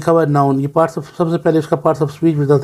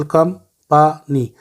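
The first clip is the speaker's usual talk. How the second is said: Company in three slow beats, कं-प-नी; syllable by syllable; much slower than usual. Shown as a demonstration. Teacher showing pupils the first syllable onward, company split up. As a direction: separate each syllable of company. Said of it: "पहला syllable".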